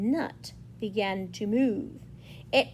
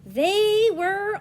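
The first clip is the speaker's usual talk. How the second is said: loud, high, stretched-out announcement; excited race start; 10 semitones higher, 8 dB louder than usual. A narrator's reading. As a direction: launch the race with long vowels.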